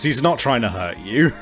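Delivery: ordinary talk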